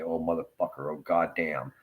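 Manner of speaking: normal talking voice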